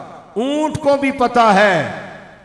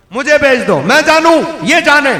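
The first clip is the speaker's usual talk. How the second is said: louder and higher, punch it; loud repeat; 6 semitones higher, 10 dB louder than usual. Acted. Preacher repeats the camel's offer to the crowd, loud. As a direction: hammer the words home.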